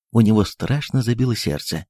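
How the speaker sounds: plain reading voice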